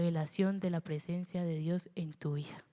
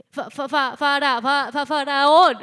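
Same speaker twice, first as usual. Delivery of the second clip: stuttering on fa-, rising and much louder; 7 semitones higher, 12 dB louder than usual. Acted. Moses stammering the word faraón before God. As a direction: stammer hard, straining to get the word out. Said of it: "Moisés era tartamudo".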